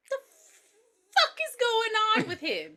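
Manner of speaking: high, slow disbelief; incredulous aside; bewildered mother. Acted. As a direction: draw it out in high-pitched bafflement.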